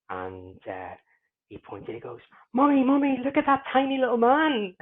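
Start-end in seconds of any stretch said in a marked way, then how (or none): 2.5-4.7 put-on loud, very high child voice; high-pitched, loud imitation of a small kid; excited little kid. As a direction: mimic a child calling out to mum. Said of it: "a little kid was getting out".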